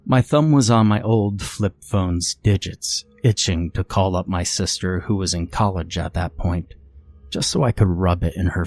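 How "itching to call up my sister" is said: usual speaking voice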